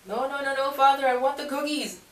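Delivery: put-on high, childlike begging; whiny pleading. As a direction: plead like a kid who wants the treat. Pleading with God over cookies.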